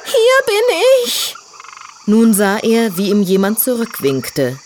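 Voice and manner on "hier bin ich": high, slow scared piglet call; frightened voice. Acted. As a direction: call out in fear, high and drawn out. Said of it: "die verängstigte Stimme".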